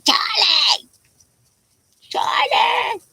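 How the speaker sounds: high, shrill-pitched mock yell of a name; mimicking a scream. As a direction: imitate the nasty neighbor woman screaming for her dog. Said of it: "I'd hear them screaming for their dog".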